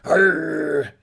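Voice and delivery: loud, drawn-out pirate growl; hammed-up pirate "arr". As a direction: roar it like a movie pirate. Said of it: "hearing some feedback from my voice back there".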